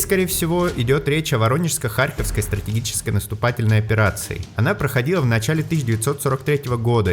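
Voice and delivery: usual speaking voice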